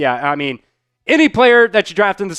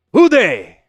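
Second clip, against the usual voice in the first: put-on Who day said in a clumsy German accent, higher and louder; 5 semitones higher, 6 dB louder than usual. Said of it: "how would you say who day in, like, a German accent".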